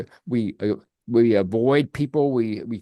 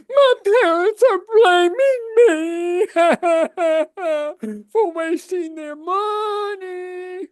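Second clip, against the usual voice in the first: much higher, louder, slow, mock-wailing silly voice; 8 dB louder, much slower than usual. Shown as a demonstration. Therapist demonstrating the silly voice he asked the student to use, mock wailing. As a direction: wail it out like a ridiculous cartoon crybaby. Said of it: "with a very silly voice".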